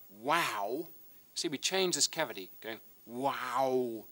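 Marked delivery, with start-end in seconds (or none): 0.1-0.9 "Wow" with the mouth opening wide, then closing; drawn-out wow. Shown as a demonstration. Teacher showing pupils how the mouth shapes "Wow". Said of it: "if we want to say something like". 3.0-4.0 "Wow" with the mouth opening wide, then closing; drawn-out wow. Shown as a demonstration. Teacher showing pupils how the mouth shapes "Wow". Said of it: "open it up and close it again".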